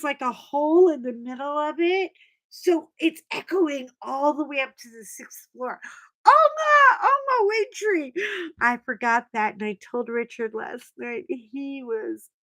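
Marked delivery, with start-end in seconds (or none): 6.3-8.1 high, loud fan scream; excited shriek to a celebrity; screaming her name. Retold or imitated. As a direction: call across the echoing mall. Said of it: "I'm screaming it so loud".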